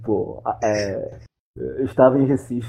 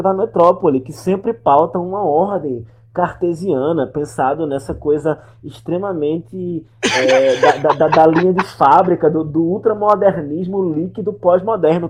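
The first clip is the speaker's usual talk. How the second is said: mock academic rant; 5 semitones higher, 9 dB louder than usual.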